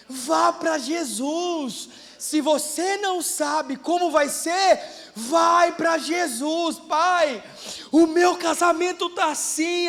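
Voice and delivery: impassioned sermon climax; preaching at full force. Real, not acted. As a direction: build to a fervent climax.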